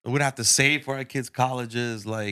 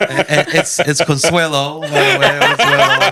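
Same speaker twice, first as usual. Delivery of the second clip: laughing, loud; 4 semitones higher, 10 dB louder than usual. Real, not acted. Laughing correction of name.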